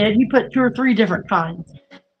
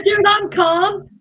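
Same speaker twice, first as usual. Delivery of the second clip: mock high protest; 10 semitones higher, slower than usual. Retold protest to doctor.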